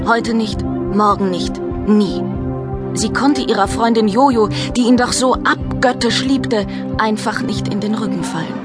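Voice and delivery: ordinary delivery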